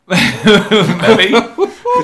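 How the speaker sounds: talking through real laughter; laughing. Real, not acted. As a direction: crack up while answering, starting loud and high. Cracking up at co-host's guesses.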